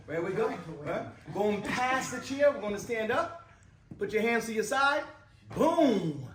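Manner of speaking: loud, keyed-up finish; rousing guide ending on a punchy shout; excited. Real, not acted. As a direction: call the last steps out with excitement. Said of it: "your voice was a little more excited".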